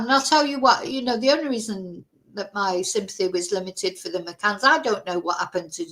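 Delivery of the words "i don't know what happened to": ordinary talk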